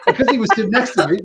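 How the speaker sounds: ordinary talk, nothing marked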